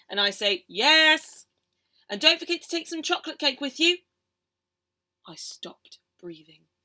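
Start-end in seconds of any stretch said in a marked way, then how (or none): 0.7-1.5 loud yes. Acted. Child answering mum. 2.1-4.0 mum's voice. Acted. Mum voiced.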